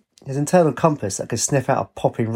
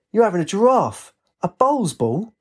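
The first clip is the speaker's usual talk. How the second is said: high, indignant outburst; crying out; 9 semitones higher than usual. A narrator's reading. Lynx hit by a bowls ball, crying out indignantly. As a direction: protest in pained disbelief. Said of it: "he cried".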